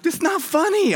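very high, squeaky mock protest; indignant protest. Pastor protesting to his laughing boss, comic indignation. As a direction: object with the voice leaping up.

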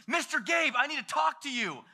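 put-on high, urgent child voice; high-pitched, insistent tattletale; agitated kid. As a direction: imitate an upset little boy calling the leader. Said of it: "you gotta chill".

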